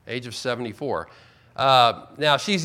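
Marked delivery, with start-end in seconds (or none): 0.1-1.1 softer, dropped repeat for weight; quiet echo. Shown as a demonstration. Preacher repeating the age to his congregation, softly. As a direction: let it sink in, said softly.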